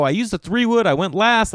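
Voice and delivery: high-pitched, flustered excuse; mock defensive. Golfer explaining himself to the old people, mock defensive.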